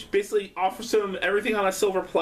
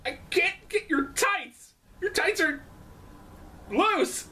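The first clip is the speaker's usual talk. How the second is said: mock wrestler; 8 semitones higher, 3 dB quieter, slower than usual. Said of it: "It's like".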